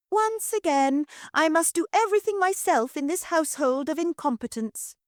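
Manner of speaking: very high, shrill-pitched commanding matron; imperious mistress. Acted. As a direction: order the maid about from on high. Mistress self-pitying.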